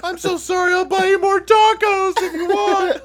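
put-on high, loud, slow laughing girl voice; apologetic girl. Girl apologizing to the biker, high-pitched and laughing. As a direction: squeak out the apology. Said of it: "she's like".

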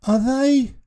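put-on high, eager boy's question; hopeful question. A narrator's reading. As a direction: ask with rising hope, pitched well up. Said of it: "he began".

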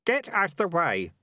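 high, contained shout; urgent boy clearing people aside; shouted warning. A narrator's reading. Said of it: "shouted Keith".

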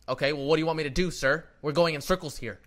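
loud, fed-up retort to a clerk; retold exasperation. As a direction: re-enact an exasperated customer raising his voice. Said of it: "like that".

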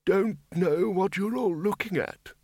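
put-on high, slow old codger voice; cheerful old man. A narrator's reading. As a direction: chirp it slowly, high-pitched, as a cheerful old wizard. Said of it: "said Windle cheerfully".

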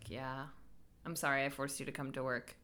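low, soft aside as inner thought; muted, low-pitched imagined reply; unspoken thought. Retold or imitated. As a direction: voice it under your breath, lightly. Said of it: "what she was thinking in her head".

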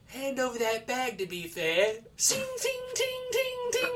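prim mugging line, then very high sung sword clangs; hammed-up British meme with high ting sounds; mock polite British mugger. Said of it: "And it was just like".

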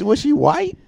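can't believe what he's hearing; surprised. Real, not acted. Shocked question about the mom.

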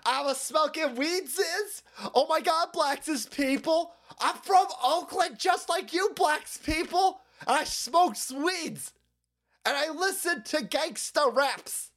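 high, slow mock pandering voice; hammed-up high pretend-relatable drawl; mocking Kamala Harris. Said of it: "Kamala Harris does this shit".

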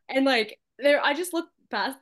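usual speaking voice

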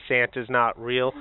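slow, deliberate reading; solemn reveal. Pretend. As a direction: read the note with weight. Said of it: "a note in it".

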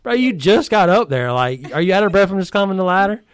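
teasing disbelief, pitched well up; mock a coworker in disbelief, high and light; incredulous teasing. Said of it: "looking at him like".

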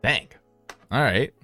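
usual speaking voice

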